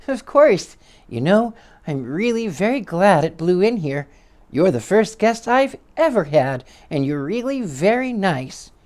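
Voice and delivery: high, hesitant timid voice; shy squeaky voice. Acted. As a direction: squeak it out shyly. Little man voice.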